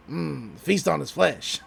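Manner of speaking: put-on low, quiet old captain voice. Old captain eyeing his catch, low menacing voice. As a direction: savor it like a sinister old sea captain. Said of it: "the old captain says".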